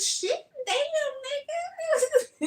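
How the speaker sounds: very high, laughing "damn"; excited squeal. Retold or imitated. Admiring the FedEx man, high laughing squeal. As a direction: squeal it with delight.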